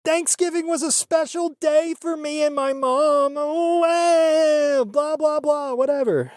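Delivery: high mock-weepy whine; mocking mother's fake lament; mocking sob voice. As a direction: ham up her fake crying, dismissive. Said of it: "just in there crying like".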